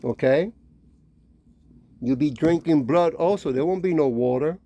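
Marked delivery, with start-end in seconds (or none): none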